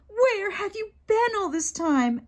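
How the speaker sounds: high-pitched indignant demand; child telling off a late dad; scolding little sister. Acted.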